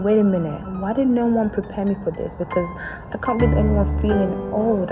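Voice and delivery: ordinary delivery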